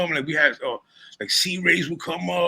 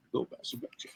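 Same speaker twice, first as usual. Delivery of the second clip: breathy whisper, much softer; whispered repeat; 3 semitones lower, 14 dB quieter than usual. Real, not acted. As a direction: drop to a hushed whisper for the echo.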